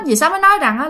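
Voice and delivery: ordinary delivery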